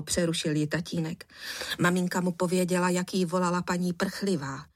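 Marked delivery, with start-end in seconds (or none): none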